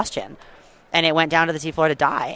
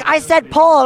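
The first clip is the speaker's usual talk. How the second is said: higher, louder barked order; captain yelling; 12 semitones higher, 5 dB louder, slower than usual. Retold or imitated. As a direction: snap at her to pull harder. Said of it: "Then he's like".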